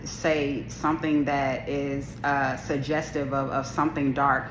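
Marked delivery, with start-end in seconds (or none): none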